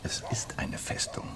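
breathy whisper, nearly silent; a hushed whisper about the fortress. Acted. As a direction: lean in and whisper it like a secret.

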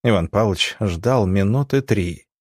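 ordinary delivery

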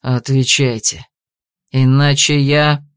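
low, slow menacing threat; threatening. Acted. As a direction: threaten him, deep and slow. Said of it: "угрожающе начал Гайрон".

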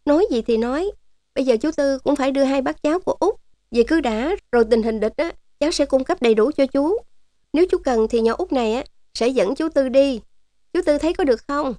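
high-pitched coquettish niece voice; wheedling young niece. A narrator's reading. Niece coaxing Uncle Tư to take them to base, wheedling. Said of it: "Giọng nũng nịu của một đứa cháu gái".

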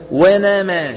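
ونما said as "wename", a's bent toward eh; tilted fatha. Retold or imitated. Teacher mimics the mistake, fatha tilted toward ya. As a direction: bend each a in ونما toward e. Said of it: "بيخلط صوت الفتحة".

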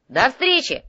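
high, loud goodbye; cheery farewell. Acted. Courier heads back into the portal, calling cheerily. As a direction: toss a lively goodbye on leaving.

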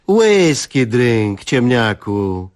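very slow, spelled-out scolding; slow lecturing. Acted. Wife lecturing husband slowly, hands on hips. Wzięła się pod boki i westchnęła.

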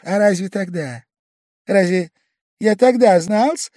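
high, rising loud protest; sudden outburst. A narrator's reading. Pavel Pavlovich suddenly flaring up at Velchaninov, high and loud. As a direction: leap up as if from behind a corner. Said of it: "вскинулся вдруг".

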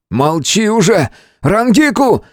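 high, impatient shout; yelling. A narrator's reading. Hero silences friend and calls healer, yelling. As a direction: shout over him, climbing high. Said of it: "не переставал орать".

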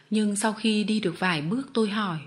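ordinary delivery, nothing marked

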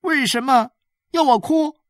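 put-on high-pitched child's question; boy asking back. A narrator's reading. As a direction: ask back in a little boy's high voice. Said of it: "伊勒在屋顶上问他".

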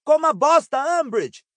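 high, loud ringing jeer; booming high proclamation; resonant voice. A narrator's reading. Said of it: "em voz ressonante".

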